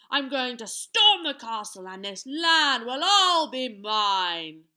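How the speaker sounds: loud, menacing giant voice; wicked giant. A narrator's reading. Giant threatens to storm the castle, wicked and loud. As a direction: boom out the threat, swelling at the end. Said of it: "the wicked giant said".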